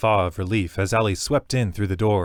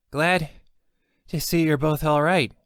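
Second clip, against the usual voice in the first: high, bright gnome voice; 7 semitones higher than usual. Acted. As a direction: speak like a small friendly gnome. The gnome speaks.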